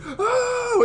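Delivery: high, loud group "Oh!" of amazement; crowd cheer. Retold or imitated. Press room crowd erupting at a comeback win, loud high cheer. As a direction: whoop it up like a crowd going nuts. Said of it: "everyone's like".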